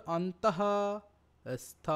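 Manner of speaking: অন্তঃ with audible final h, a pause, then স্থ; spelled-out syllables. Shown as a demonstration. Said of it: "বানানটা খেয়াল কর".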